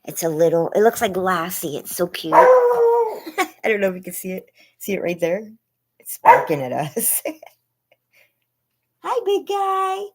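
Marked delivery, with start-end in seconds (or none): 2.3-5.5 much higher, louder giggly words; laughing. Real, not acted. Streamer giggling while showing viewers a dog. 7.2-10.0 much higher cooing hello. Real, not acted. Streamer cooing at a barking neighbor dog.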